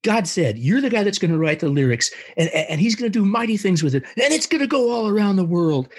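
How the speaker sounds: excited announcement. Retold or imitated. Keith announcing God's calling on phone.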